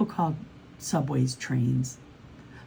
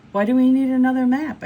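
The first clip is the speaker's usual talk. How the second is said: high, loud, quick protest; incredulous question; 9 semitones higher, 9 dB louder, faster than usual. A narrator's reading. Mai questions Pablo about yet another map, incredulous. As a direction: fire it off in loud disbelief.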